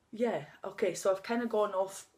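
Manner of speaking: ordinary talk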